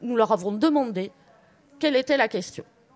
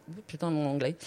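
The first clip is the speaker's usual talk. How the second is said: plain reading voice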